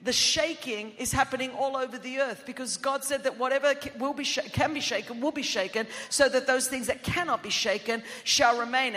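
usual speaking voice

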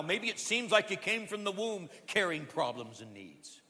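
ordinary delivery

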